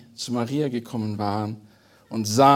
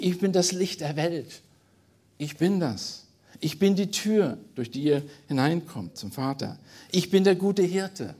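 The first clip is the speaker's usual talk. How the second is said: I am sayings ringing out higher; proclaiming; 5 semitones higher than usual. Acted. Preacher proclaiming Jesus' I am sayings to the congregation, ringing and emphatic. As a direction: lift each Ich bin like a proclamation.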